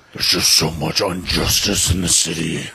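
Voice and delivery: low, gruff brooding hero voice; mock Batman. Teasing a friend as Batman, in a low, brooding voice. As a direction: brood like Batman over the city. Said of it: "you're like Batman".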